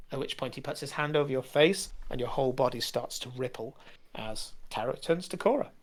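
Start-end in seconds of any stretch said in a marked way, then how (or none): none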